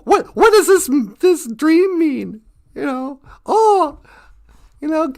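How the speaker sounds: worked-up, emphatic mock complaint; exasperated grumbling. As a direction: rant like a fed-up eagle pestered by seekers. Eagle grumbling about constant seekers.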